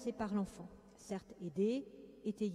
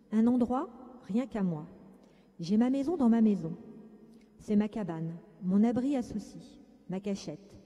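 put-on louder, steady read-aloud of a child's first-person tale; calm story reading; 5 dB louder than usual. A narrator's reading. Reading the tipi story to listeners with closed eyes, calm and serene. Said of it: "censée se faire sur un temps calme, serein".

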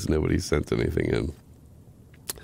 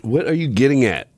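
defensive; 6 semitones higher, 5 dB louder than usual. Real, not acted. Confronting the judgy friend.

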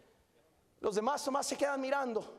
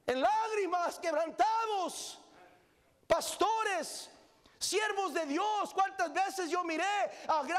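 fiery sermon peak; preaching at full force; 6 semitones higher than usual. Acted. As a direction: lift the congregation, remembering the altar. Preacher recalling revival, shouting.

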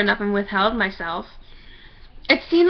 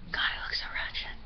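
whispered aside; 16 dB quieter than usual. Real, not acted. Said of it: "whisper aside".

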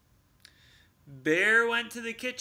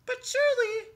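mouse voice; 5 dB louder, faster than usual. Acted. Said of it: "said Mouse".

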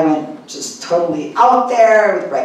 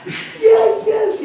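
mock tearful yes. Tearful believer agreeing.

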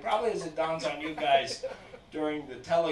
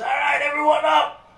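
high, loud call to the whole plane; instructor shout; 11 semitones higher, 8 dB louder than usual. Retold or imitated. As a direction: bark it over the wind.

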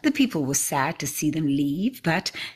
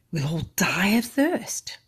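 slow, mild despairing cry; cried; 2 semitones higher, slower than usual. Acted. Villagers crying out to Elzevir, mildly despairing and slow. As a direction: villagers lamenting their thirst, a touch of despair. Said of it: "they cried".

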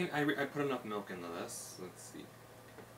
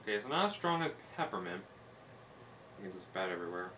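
talking while chewing; 3 semitones lower, 3 dB quieter than usual. Real, not acted. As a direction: mouth partly full of cookie. Seen 1: Hand at mouth after bites, jaw working while talking.

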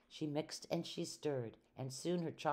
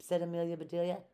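usual speaking voice

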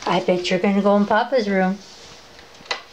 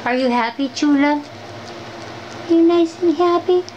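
pet talk; 6 semitones higher than usual. Real, not acted. Owner cooing at her happy dog, high sing-song baby talk. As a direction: coo happily at the dog.

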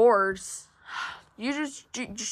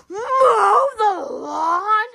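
higher, slow, gasping disbelief at mow the lawn; shocked gasp; 7 semitones higher, much slower than usual. Acted. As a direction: gasp and drag it out in horror. Kid repeats chore with a gasp.

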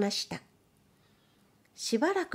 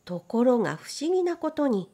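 ordinary delivery

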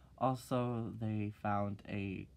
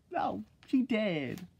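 sassy reply; 9 semitones higher, 3 dB louder, much slower than usual. Retold or imitated. Sassy answer to her own question.

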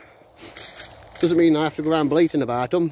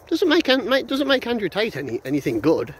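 high, scornful dismissal; scornful rant; 8 semitones higher than usual. Real, not acted. Vlogger ranting at Andrew Tate, high scornful dismissal. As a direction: cut the big head down to size.

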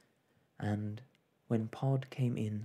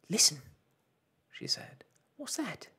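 urgent alert; 11 semitones higher than usual. Acted. Mother hears a noise, urgent alert. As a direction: cut in, suddenly on guard.

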